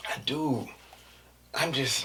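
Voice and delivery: ordinary delivery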